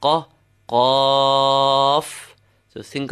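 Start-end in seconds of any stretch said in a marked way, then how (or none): 0.0-0.3 Qa cut short, ah not held; short letter sound. Shown as a demonstration. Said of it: "initial sound of the letter". 0.7-2.4 qaf with the ah pulled long; extended letter sound. Shown as a demonstration. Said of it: "speaking of the extension".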